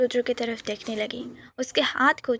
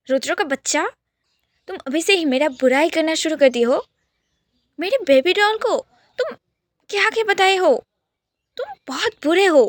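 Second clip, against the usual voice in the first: higher and louder, hammed-up possessive scolding; mock angry tirade; 3 semitones higher, 7 dB louder than usual. Yash teasing Rudra about his baby doll sister, hammed-up loud scolding. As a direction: play the jealous big brother, over the top.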